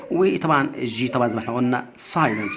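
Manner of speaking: normal talking voice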